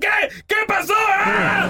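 very high-pitched frightened shouting; comic panic ending in a scream; acted panic. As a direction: play a driver startled out of his wits.

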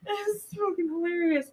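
ordinary delivery

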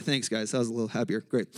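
normal talking voice

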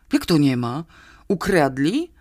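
rising, worried questions; alarmed question. A narrator's reading. Policeman fearing a circus theft, alarmed questioning. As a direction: question him with growing alarm. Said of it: "zaniepokoił się milicjant".

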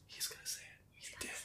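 very soft, voiceless whisper; audience whisper. Retold or imitated. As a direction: imitate hushed whispering in a crowd. Acting audience members whispering.

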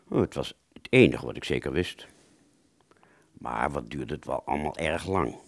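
ordinary delivery, nothing marked

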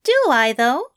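high, bewildered fussy voice; baffled angel voice. A narrator's reading. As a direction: ask in baffled disbelief.

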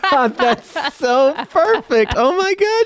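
high, loud and thrilled; overjoyed that she guessed it; excited delight. Real, not acted. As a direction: squeal with delight at being guessed. Host delighted his guess landed.

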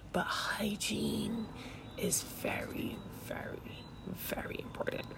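slow breathy whisper for emphasis; dramatic whisper. Acted. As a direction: drop to a slow stage whisper.

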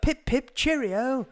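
very high, plummy British caricature; mock British accent. Brit imitating Americans doing British, high hammed-up accent. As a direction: parody an American's idea of British. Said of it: "do an accent of British people".

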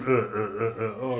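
stuck, halting sounds with a laugh; fail to get words out; hammed-up tongue-tied grunting; mock stammering. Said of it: "me going".

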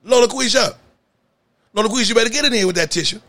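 loud high call across the room; high, fast shout for someone missing. Real, not acted. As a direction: yell her name to get her in here. Calling out for Lola Quisha.

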